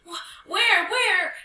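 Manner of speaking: high, loud, stammered question; alarmed cry. Acted. Young hyena racing behind the fleeing animals, crying out. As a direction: cry out in a panic, voice shooting up. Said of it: "cried Penduli as she raced behind them".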